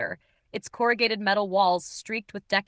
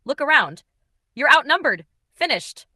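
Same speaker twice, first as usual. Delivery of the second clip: loud, cold call-out; 6 semitones higher, 7 dB louder than usual. A narrator's reading. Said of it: "his voice eerily calm despite the bullets".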